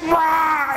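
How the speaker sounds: high shouted roar imitating his wife; mock roar. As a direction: let out a monster's roar. Wife erupts at shoe price.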